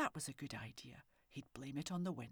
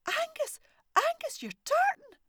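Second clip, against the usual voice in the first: high, loud astonished scream; wife sees the tartan goat and screams, high and loud; 15 dB louder than usual. A narrator's reading. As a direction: shriek it out in shock. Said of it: "screamed loudly".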